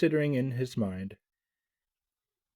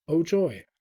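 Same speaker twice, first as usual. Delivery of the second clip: higher, louder cry of joy; joyful shout; 5 semitones higher, 5 dB louder than usual. A narrator's reading. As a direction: burst out with delight. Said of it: "The tailor gave out a shout".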